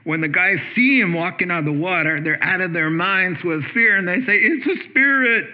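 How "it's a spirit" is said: higher mock-terrified cry. A narrator's reading. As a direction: cry out as if seeing a ghost. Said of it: "They scream in terror".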